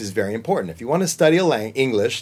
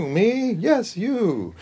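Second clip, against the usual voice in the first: character voices; 10 semitones higher, much slower than usual. Shown as a demonstration. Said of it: "one of your songs".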